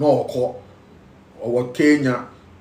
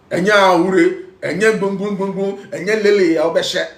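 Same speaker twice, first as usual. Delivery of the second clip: preaching shout; 5 semitones higher, 5 dB louder than usual. Real, not acted. Preaching in Twi, full force.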